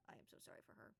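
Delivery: whispered apology; the giant quietly apologizing to Oric for her; apologetic aside. Acted. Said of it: "He's like".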